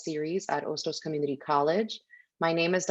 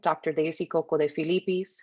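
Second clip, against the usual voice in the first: usual speaking voice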